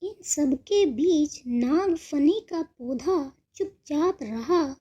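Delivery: ordinary delivery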